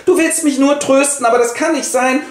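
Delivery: high, dramatic wife's voice; Alkmene voice. Acted. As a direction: throw yourself into mock despair, pitched high. Alkmene refuses comfort. (hohe, theatralisch verzweifelte Frauenstimme)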